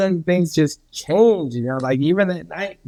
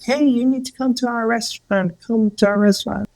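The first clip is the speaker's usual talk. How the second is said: higher-pitched mock urging; imitated insistent invitation; 8 semitones higher than usual. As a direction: press the invitation as the wife would. Imitating wife pressing an invitation.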